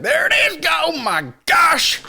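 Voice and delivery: high, drawn-out mock amazement; mock awestruck shouting. Mocking the pilots in the footage, hammed-up awestruck shout. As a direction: overdo the amazement, stretch the last word. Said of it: "Don't show me the fucking baby scan footage".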